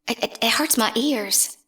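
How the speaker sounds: normal talking voice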